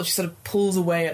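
ordinary delivery

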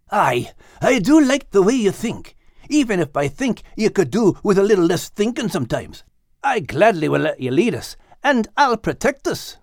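a hint of a cheerful Scots tomcat; Max, lightly Scottish. Max answering Liz with a light Scots lilt.

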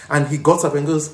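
ordinary talk

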